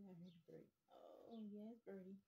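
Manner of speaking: ordinary talk, nothing marked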